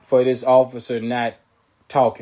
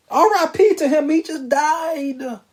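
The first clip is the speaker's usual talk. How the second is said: much higher, very slow "He just died"; hammed-up mock attorney; 14 semitones higher, slower than usual. Host mocking the defense attorney's excuse, drawn out and high. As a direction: stretch it out, sing-song innocent. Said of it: "he's trying to say".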